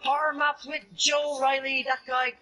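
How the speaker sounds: high, hyped channel intro voice; YouTuber intro. Acted. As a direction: open the video like a streamer. YouTube intro, announcer style.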